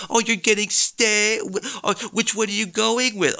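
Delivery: nosy friend. Retold or imitated. Mimicking nosy dining companions.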